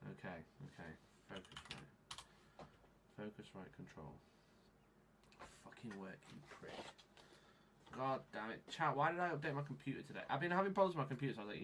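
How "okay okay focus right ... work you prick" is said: very soft, low mutter; hushed, irritated self-talk; muttering to oneself. Real, not acted. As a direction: grumble under your breath at the computer. Muttering to himself at the software.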